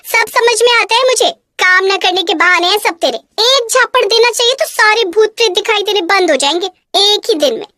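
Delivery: calling out, then a sharp scold; a harsh stepmother yelling for the girl. Acted.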